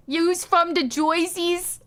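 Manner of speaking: mock Jersey accent. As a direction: ham up a Jersey accent, drawn out.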